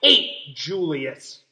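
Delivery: slow, sulky declaration; spiteful big sister. Acted. Lily sulking about her new brother, slow and spiteful. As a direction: drag out the hate like a jealous child. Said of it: "said Lily".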